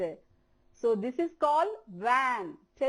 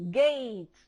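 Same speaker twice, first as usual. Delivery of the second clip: ordinary talk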